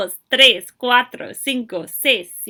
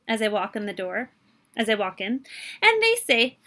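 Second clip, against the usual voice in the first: ordinary delivery, nothing marked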